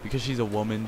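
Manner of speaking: normal talking voice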